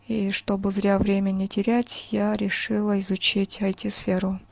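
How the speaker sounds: usual speaking voice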